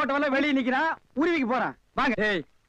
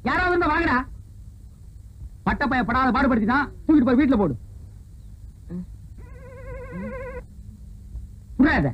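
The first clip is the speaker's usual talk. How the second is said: angry shouting. Acted. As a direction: bellow it out in disgust. Angry at drunk on his feet.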